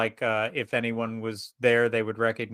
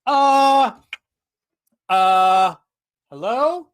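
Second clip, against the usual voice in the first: high, loud cries turning to questioning hellos; yelp, then call out high into the empty room; startled; 13 semitones higher, 9 dB louder, much slower than usual. Acted. Player's character answering a voice in their head, startled. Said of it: "I drop the book, and I'm just like".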